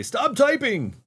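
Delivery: high, loud mock command; hammed-up scolding shout; retold yell. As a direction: bark it at the chat. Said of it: "Laura's telling everybody".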